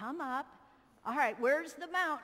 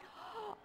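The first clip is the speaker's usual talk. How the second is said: sharp high gasp of wonder; mock gasp; 11 semitones higher, 10 dB quieter than usual. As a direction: gasp as if dazzled. Light appears in the scene.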